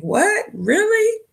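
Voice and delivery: very high, rising startled questions; mock disbelief. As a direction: squeak it, imitating a taken-aback girlfriend. Voices girlfriend's startled reply to outburst.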